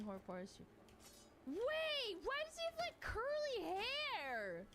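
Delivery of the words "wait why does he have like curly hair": shocked outburst. Real, not acted. Streamer shocked at Malfoy's curly hair, high disbelief. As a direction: jump up in pitch, stunned. Shocked reaction to the new casting.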